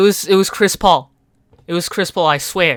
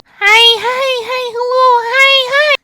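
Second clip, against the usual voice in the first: very high, tiny cartoon hellos; squeaky hi; 8 dB louder than usual. Meme greeting, squeaked very high.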